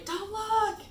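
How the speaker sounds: high, sharp call to the family; yelled warning. Retold or imitated. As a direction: shout it out in a rush. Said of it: "As I yell to the rest of the family".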